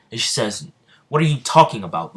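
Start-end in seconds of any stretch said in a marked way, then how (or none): 1.1-2.1 quick, loud, higher indignation; indignant protest. Acted. Noel demands Asta let her go, indignant protest. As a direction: fire it back fast and raised.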